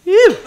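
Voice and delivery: loud high whew after a shot; sharp exhale after a shot. Real, not acted. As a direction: blow out the burn of the liquor. Just took a shot.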